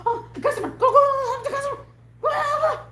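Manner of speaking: high, laughing, shouting mock boss; frantic boss imitation. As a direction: yell at her to go help, hammed up. Boss hurrying her to customers.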